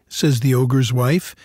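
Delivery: ordinary delivery, nothing marked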